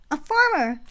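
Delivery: put-on loud, high excited kid; child shouting. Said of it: "A child shouted".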